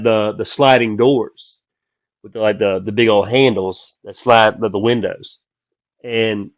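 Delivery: ordinary delivery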